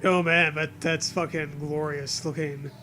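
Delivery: ordinary talk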